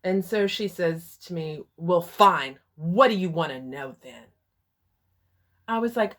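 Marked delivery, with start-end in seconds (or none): 1.8-4.2 angry retort. Retold or imitated. Mother snapping angrily.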